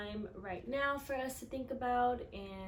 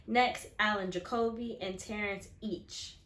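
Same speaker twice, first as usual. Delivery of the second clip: put-on reading to children; 7 dB louder than usual. A narrator's reading. Teacher reading a picture book to her class, expressive storytelling. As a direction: read it out to the class with feeling.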